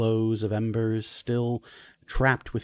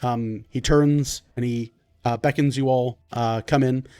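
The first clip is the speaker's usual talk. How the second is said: ordinary talk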